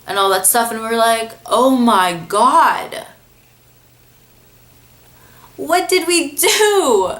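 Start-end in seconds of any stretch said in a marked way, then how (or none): 1.4-7.1 slow, stunned oh my God, pitch jumping high; like the prank just blew up in your face; half-acted panic over what we did; retold panic. Panicking after fooling the girl.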